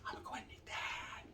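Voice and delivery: whispered "I'm gonna go ahead and need that", no voice; breathy whispered threat, "I'm" leaned on; menacing Mexican version. Retold or imitated. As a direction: hush it like a threat from across the border. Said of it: "Over there".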